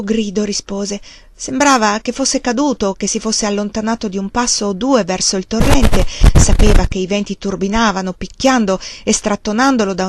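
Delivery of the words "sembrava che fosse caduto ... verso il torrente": plain reading voice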